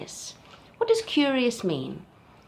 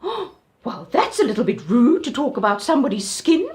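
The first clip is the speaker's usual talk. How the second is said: offended Auntie Giraffe; 3 semitones higher, 7 dB louder than usual. Acted. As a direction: sound scandalised like a prim aunt.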